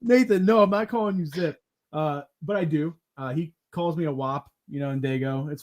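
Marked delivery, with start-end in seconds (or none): 0.0-1.6 much higher, louder no, no; loud protest. Retold or imitated. Refusing a friend's nickname, loud high protest. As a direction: shout the refusal, then settle. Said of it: "I'm like".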